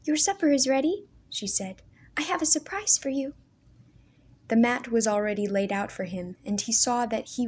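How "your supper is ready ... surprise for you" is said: put-on higher, eager girlish voice; bursting with a secret; lightly eager girl voice; eager young sister. A narrator's reading.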